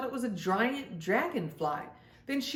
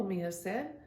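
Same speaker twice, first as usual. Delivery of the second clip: usual speaking voice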